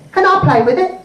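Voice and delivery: put-on high-pitched little girl; Millie. Girl asking her cousin to share, high child voice. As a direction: ask like an eager five-year-old. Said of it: "So Millie said".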